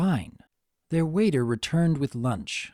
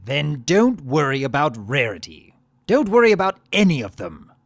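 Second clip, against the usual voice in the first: louder, darker menace; cruel; 8 dB louder than usual. Acted. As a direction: turn cold and cruel. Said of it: "mouth took a cruel set".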